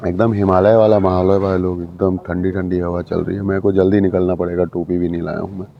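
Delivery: ordinary talk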